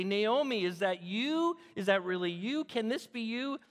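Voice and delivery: high, rising questions of disbelief; astonished recognition. Acted. Townspeople gathering round the returning woman, amazed. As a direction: exclaim the name, then question in mounting amazement.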